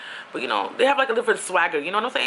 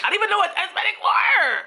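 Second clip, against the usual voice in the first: much higher and near-shouted disbelief; real exclamation; 13 semitones higher than usual. Real, not acted. Telling listeners about her childhood, exclaiming in disbelief.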